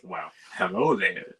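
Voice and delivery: swooping, put-on flirty greeting; Marv. Marv greets the woman nearby, swooping mock-flirty voice. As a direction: slide the pitch up and down on a mock come-on. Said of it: "Marv was like".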